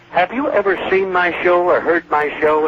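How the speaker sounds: a hint of Jack's exasperated whine; lightly voiced Benny scolding the director; Jack Benny, indignant. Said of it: "Jack turned around, and he said".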